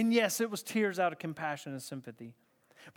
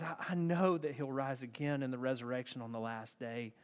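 tearful; 6 semitones lower, 7 dB quieter than usual. Retold or imitated. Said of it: "in her tears".

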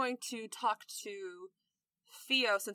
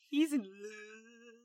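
drawn-out lovey-dovey tease; teasing; much slower than usual. Acted. Lavender teasing her blushing friend, drawn-out. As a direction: stretch the last word out slowly to tease.

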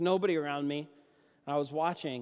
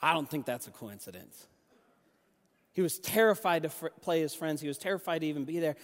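ordinary talk, nothing marked

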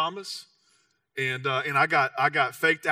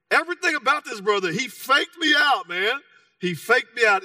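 preaching build; 6 semitones higher, 5 dB louder than usual. Real, not acted. Pastor hammering the lesson to the church, higher and louder. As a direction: drive it home from the pulpit.

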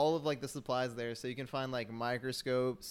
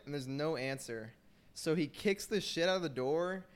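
normal talking voice

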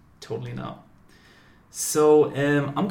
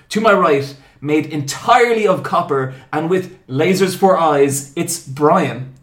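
big, loud ringmaster introduction; booming mock-epic host intro; show announcer; 3 semitones higher, 9 dB louder than usual. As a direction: proclaim it grandly, punching key words. Hosts the show's grand introduction.

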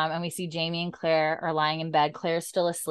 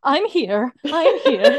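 high, loud mock panic; freaked out; 9 semitones higher, 9 dB louder, slower than usual. Freaked-out husband reassures his labouring wife, mock panic. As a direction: imitate a terrified husband trying to help. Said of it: "he looks so freaked out".

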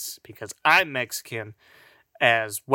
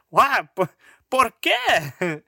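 mock Spain asking why; 9 semitones higher, 5 dB louder, slower than usual.

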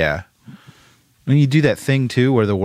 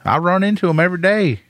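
high, quick Southern caricature; fast put-on Southern voice; exaggerated Southern accent; 10 semitones higher, faster than usual. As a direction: pitch it up into a hokey Southern twang. Joking proof Southerners talk that way.

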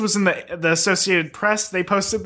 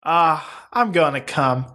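mock climax; much slower than usual. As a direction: groan it out in hammed-up ecstasy. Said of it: "reached his climax".

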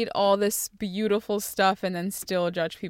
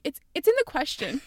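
higher-pitched mock teacher voice; teacher's reply; 6 semitones higher than usual. Teacher answering a student during the test, lightly mimicked. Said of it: "teachers will be like".

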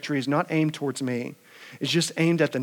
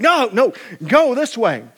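firm rider command; 7 semitones higher, 6 dB louder than usual. Acted. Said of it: "you can be like".

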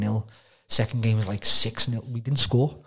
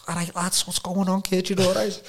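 higher, lively Scouse lad greeting; 9 semitones higher than usual. Retold or imitated. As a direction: Liverpool North End lad calling out to mates. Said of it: "he's like".

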